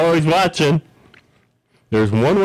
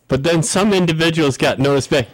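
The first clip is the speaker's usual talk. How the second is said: ordinary delivery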